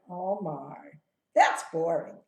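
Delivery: imitating a bored child saying "Oh my"; flat, uninterested mock "Oh my". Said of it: "some of you said it kind of boring".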